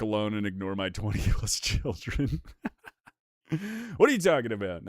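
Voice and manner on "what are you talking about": ordinary delivery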